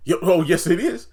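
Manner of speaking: hammed-up defiance; mock defiant Nancy. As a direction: insist right back.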